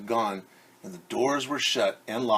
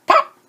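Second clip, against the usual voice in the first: pop; 12 dB louder than usual. A narrator's reading. Button pops off.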